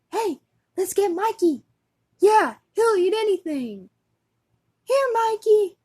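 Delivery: high, loud put-on kid calls; acted eager brothers. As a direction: rope in little brother with big, high voice. Boys recruit Mikey, acted ad.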